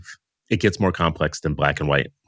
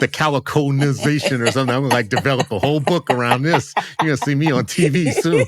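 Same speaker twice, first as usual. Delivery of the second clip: higher-pitched, giggly delivery; laughing; 7 semitones higher, 3 dB louder than usual. Real, not acted. Joking to the co-host about a coined word, laughing. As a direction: laugh through your own joke.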